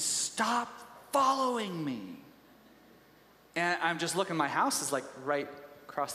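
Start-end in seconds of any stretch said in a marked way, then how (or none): 0.0-2.0 sharp, higher voice of a frightened woman; angry stranger. Retold or imitated. Woman on the street accusing the pastor, sharp and high. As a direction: snap it at the man you think is tailing you.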